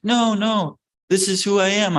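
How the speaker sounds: high-pitched insistent denial; pleading protest. Retold or imitated. Acted protest against an accusation.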